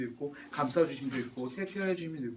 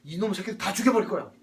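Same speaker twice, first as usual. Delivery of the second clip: loud, raised wrathful threat; acted divine anger; 3 semitones higher, 5 dB louder than usual. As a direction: play God pronouncing judgment in anger. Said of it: "다 죽이겠다고 하나님이 화를 내요". (노하여 언성을 높이는 하나님 연기)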